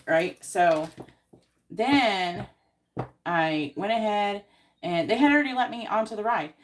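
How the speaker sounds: normal talking voice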